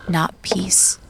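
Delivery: ordinary talk, nothing marked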